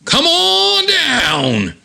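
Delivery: high, long-drawn announcer holler; game show call. Acted. Nazi minion from heck calling the contestant, drawn-out high holler. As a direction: stretch the words out high like a game show host. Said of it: "this Nazi minion from heck goes".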